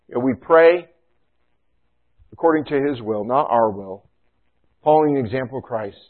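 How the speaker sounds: ordinary talk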